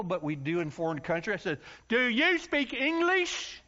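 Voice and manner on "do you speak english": high, slow, overloud question; yelling at a foreigner. Retold or imitated. Preacher yelling slowly at a Hungarian boy. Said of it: "I don't know why we yell at people".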